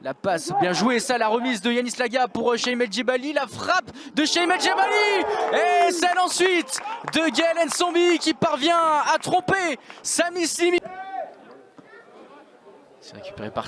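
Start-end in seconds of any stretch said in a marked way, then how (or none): 0.6-10.8 building to a loud shout; excited goal call. Real, not acted.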